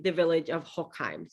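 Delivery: ordinary talk, nothing marked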